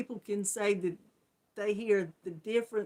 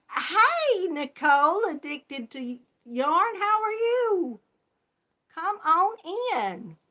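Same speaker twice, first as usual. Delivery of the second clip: high, loud, drawn-out welcome; excited greeting; 9 semitones higher, 5 dB louder than usual. Real, not acted. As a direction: call out a big hello to a newcomer. Calling out greeting to arriving viewer.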